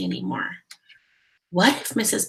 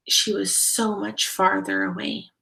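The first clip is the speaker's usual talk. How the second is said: ordinary delivery, nothing marked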